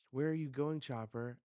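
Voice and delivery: lightly acted call to someone running off. Someone asks Chopper where he's going, calling out.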